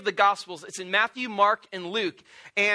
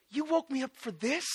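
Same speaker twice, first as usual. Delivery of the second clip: mildly irritated, softer mock disbelief; annoyed Jesus; 2 semitones higher, 7 dB quieter than usual. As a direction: grumble like someone needlessly woken. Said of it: "Jesus is almost more annoyed".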